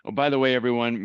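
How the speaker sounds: ordinary talk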